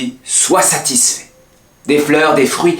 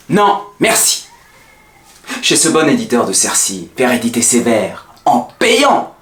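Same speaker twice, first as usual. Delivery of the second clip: mounting tirade; 8 semitones higher than usual. Acted. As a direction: pitch climbing much higher, heated. Tirade of refusals escalating.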